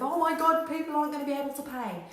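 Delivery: loud, high, fast mock panic; hammed-up worry about unpaid bills; panicking. Mocking panicked business owner.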